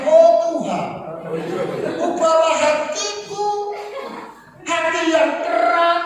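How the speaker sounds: tinggi dan lambat, seperti bernyanyi; gaya doa rohani. Retold or imitated. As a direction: doa yang dilantunkan tinggi. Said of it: "kalau yang rohani". (meniru lantunan doa rohani)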